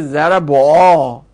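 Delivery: "ضربا" said zarabo-aa, with an o before the long a; awkward damma pronunciation. Shown as a demonstration. Teacher tests damma before alef, awkward "ضربا" as zarabo-aa. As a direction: stretch "ضربا" into a clumsy zarabo-aa. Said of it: "ضمه بیادش".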